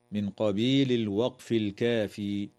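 ordinary talk

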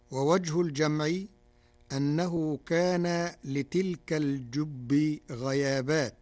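ordinary talk

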